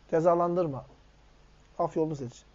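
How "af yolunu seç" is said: af yolunu seç said softer and lower, af gently leaned on; soft restatement. Real, not acted. Preacher repeating his advice to the congregation, now soft and settled.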